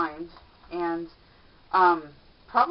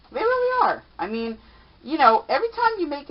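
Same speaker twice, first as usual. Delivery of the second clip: excited; 9 semitones higher, 6 dB louder than usual. Real, not acted. Enthusing about impressing people.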